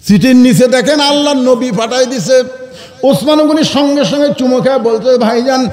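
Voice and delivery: chanted preacher's narration, pitched high; high-pitched sermon storytelling. Acted.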